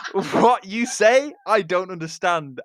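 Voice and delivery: teasing through laughter; laughing. Acted.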